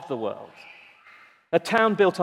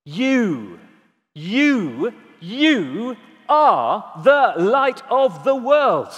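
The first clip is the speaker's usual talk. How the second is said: you repeated, building louder, last line slow; emphasis on you; 3 semitones higher, 4 dB louder, much slower than usual. Shown as a demonstration. Preacher driving home "you" to the church, building and slow. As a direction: stack the yous, then land on "are". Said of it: "the emphasis is on you".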